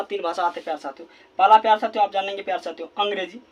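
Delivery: usual speaking voice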